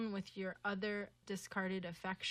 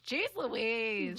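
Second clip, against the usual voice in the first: slightly louder annoyed parrot; 3 dB louder than usual. Retold or imitated. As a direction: say it like an irritated parrot. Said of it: "And when it was annoyed, it would sound like".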